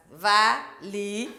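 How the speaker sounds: "valise" syllable by syllable, slowly; separado en sílabas. Shown as a demonstration. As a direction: break "valise" into three slow, spaced syllables. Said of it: "de syllabes".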